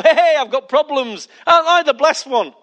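high, loud mock celebration; gleeful. Preacher as Paul no longer mournful, boasting gleefully. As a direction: crow about your troubles as if they were blessings. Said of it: "from being mournful to now".

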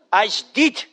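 "As deed" in a Scottish accent, long ee; Scottish "deed" with a lifted end; Scottish accent on "As deed", ee vowel stretched; Scottish pronunciation. Shown as a demonstration. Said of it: "I like the way the Scottish say it".

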